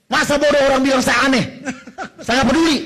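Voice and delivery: loud, high, brash declaration; defiant. Real, not acted. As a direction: throw it out boldly, not caring who objects. Defiantly defends his habit of shouting. (lantang dan masa bodoh)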